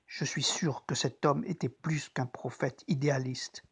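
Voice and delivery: hushed, secret confession; whisper. Acted. Private confession of belief.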